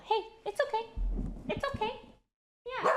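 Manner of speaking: calm the scared dog gently; soothing pet voice. Real, not acted.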